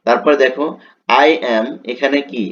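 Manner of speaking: usual speaking voice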